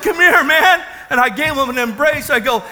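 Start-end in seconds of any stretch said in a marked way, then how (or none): 0.0-0.8 joyful. Retold or imitated. Joyful reunion embrace.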